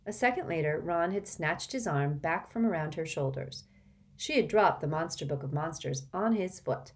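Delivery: usual speaking voice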